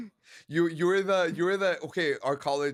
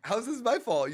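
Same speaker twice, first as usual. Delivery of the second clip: ordinary talk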